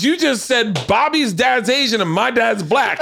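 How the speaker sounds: high, slow outraged retort through laughter; mock indignation. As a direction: act out an offended accusation, laughing. Acted indignant comeback to a psychic.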